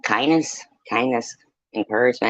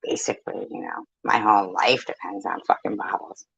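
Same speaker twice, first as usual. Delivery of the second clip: mock whisper of her story; 2 semitones lower, 5 dB quieter than usual. Mocking a rival's narrative in a whisper. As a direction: hush it like a mocking confession. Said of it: "Her narrative is".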